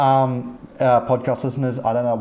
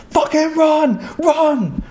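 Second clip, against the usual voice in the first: high, loud mock scream; panicked scream imitation; 6 dB louder, much slower than usual. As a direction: scream it out like a fleeing player. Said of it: "I hear him scream".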